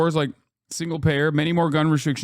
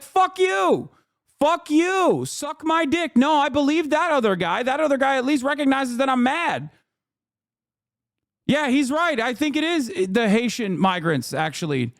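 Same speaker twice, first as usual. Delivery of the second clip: much higher, scornful mock voter yelling back; angry fed-up voter; 10 semitones higher than usual. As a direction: play the furious voter who has had enough. Angry voter telling Democrats off.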